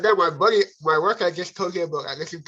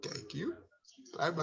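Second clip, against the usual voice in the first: very soft thanks; 14 dB quieter than usual. Retold or imitated. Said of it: "I'm just like".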